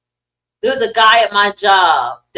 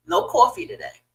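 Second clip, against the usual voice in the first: ordinary delivery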